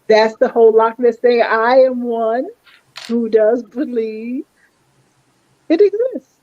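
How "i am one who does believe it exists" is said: dramatic reveal. Acted. Dramatic belief declaration.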